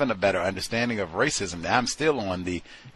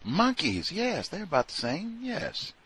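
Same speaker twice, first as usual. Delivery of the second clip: mock coworker; 10 semitones higher, 3 dB quieter than usual. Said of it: "That's how I'm talking".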